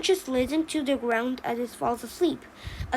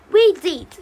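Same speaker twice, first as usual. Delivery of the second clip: loud, higher emphatic answer; defiant shout; 4 semitones higher, 8 dB louder than usual. Acted. Both pine parents answer the lodger, defiantly loud. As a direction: announce yourselves boldly.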